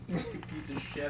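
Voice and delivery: low, slow muttered curse under the breath; muttered insult. Retold or imitated. Glaring at his buddy while the girlfriend looks away, muttering quietly. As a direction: growl it quietly so she can't hear.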